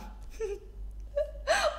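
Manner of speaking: high-pitched giggling fit; laughter. Real, not acted. Vlogger tickled by a flattering question, high giggling. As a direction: break into high, helpless giggles.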